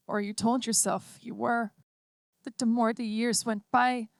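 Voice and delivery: put-on a small girl gently letting her mother go; lightly voiced child, tender and firm; little girl voice. A narrator's reading. Child android explains.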